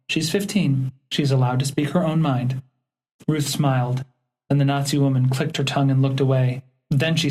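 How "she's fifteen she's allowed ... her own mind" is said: usual speaking voice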